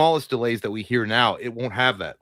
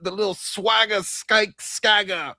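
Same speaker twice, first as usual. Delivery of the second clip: put-on drunk British frat bro; 8 semitones higher than usual. Friend mocking the British AI as a drunk frat bro. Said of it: "dude bro from a frat college in Britain".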